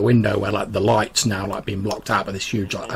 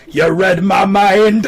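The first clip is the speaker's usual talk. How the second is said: much higher, louder, slow gleeful cry; delighted exclamation; 10 semitones higher, 7 dB louder, much slower than usual. Acted. Hamish thrilled at the eggs, slow gleeful cry. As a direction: savour every word with glee.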